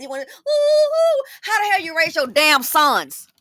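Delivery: loud outburst in slow, heated words; angry. Real, not acted. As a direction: scold the parents, boiling over. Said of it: "I'm getting mad".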